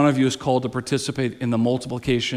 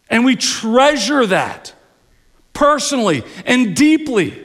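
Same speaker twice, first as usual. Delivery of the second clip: building sermon; 6 semitones higher, 3 dB louder than usual. Acted. Preacher building the point.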